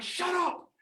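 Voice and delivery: high-pitched mock hush; mock telling-off. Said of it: "The people in front are like".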